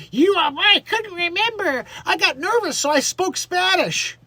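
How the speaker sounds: high, hammed-up mock excuse; mock Hilaria Baldwin. Imitating Baldwin excusing her Spanish, high mocking voice. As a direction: squeal it out in a put-on high voice.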